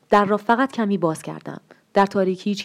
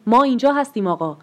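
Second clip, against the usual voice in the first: mild narrated shout, higher and louder; calling out; 5 semitones higher, 5 dB louder than usual. A narrator's reading. Father calls to the visitor that they are here, shouting. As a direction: raise the voice as the father crying out. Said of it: "پدر فریاد کشید".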